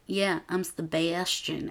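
soft, slow mock-deadpan; funny flat delivery. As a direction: say your fake name slowly and softly. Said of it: "the way she says it is so funny".

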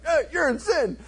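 high-pitched prophet's charge; mock accusation. Prophet confronting the king, mock accusation.

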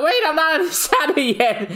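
high frantic yelp; a panicked high cartoon cry. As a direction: left behind, shouting high after the duck. Character left behind yelling.

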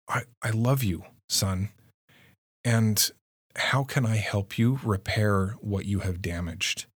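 ordinary talk, nothing marked

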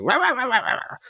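high-pitched nagging rah rah; mock nagging wife. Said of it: "the wife who was just like".

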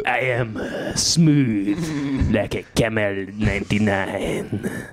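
put-on slow, suave French-accented purr; Puss in Boots accent. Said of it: "sound like Puss in Boots".

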